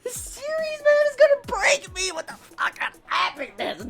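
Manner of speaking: overwhelmed whisper. Real, not acted. Overwhelmed fan, voice giving out.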